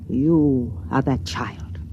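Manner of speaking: slow, hushed secret whisper. Acted. Matron secretly tells Rykud he is the child, whispered. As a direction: breathe it so no one overhears. Secret told, fearing being overheard.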